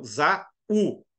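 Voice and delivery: "za-u" slowly, in two beats; split into syllables. Shown as a demonstration. Teacher showing each vowel's syllable, "za-u" slowly split in two. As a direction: separate "za" from "u" in "za-u". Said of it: "cada um numa sílaba diferente".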